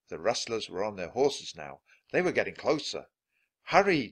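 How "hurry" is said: high, loud plea to speed up; urgent cry. A narrator's reading. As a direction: cry out with danger behind you. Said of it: "Jack cried".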